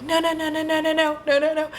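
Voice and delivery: rapid-fire high-pitched no's; high, wailing victim in a trap; mock panicked screaming. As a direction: squeal the refusals in acted panic. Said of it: "she's still screaming".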